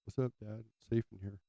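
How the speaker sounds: high, soft little pet voice; squeaky rat voice, lightly done; voicing the rat. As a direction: speak as the cosy rat in the shirt.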